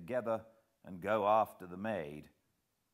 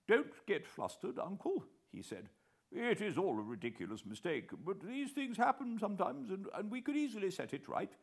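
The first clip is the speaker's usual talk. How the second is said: higher, fast, fumbling over words; picking his words nervously; stammering plump man; 13 semitones higher than usual. A narrator's reading. Plump man calms his uncle, stammering a little. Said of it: "He stammered a little".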